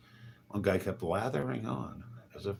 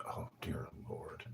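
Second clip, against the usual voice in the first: whispered, weary aside; exasperated whisper; 10 dB quieter, slower than usual. Real, not acted. Muttering to himself about pestering strangers, whispered. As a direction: breathe it out, fed up.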